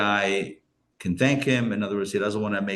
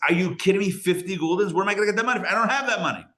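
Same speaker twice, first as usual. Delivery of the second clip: raised, higher pitched disbelief; exasperated wagon driver; 7 semitones higher, much faster than usual. Retold or imitated. Wagon driver protesting he cannot pay fifty, pitched up in disbelief. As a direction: throw up your hands in disbelief.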